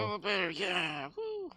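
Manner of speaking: gleeful rising whoop; excited. Real, not acted. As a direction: celebrate the transformation. Transforms into a bear.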